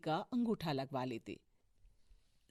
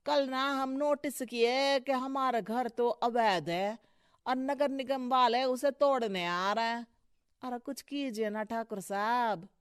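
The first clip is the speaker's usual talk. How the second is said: lightly voiced worried petitioner; a touch of wheedling appeal; pleading petitioner; 4 semitones higher, 6 dB louder than usual. Man asking Thakur to save his house, pleading.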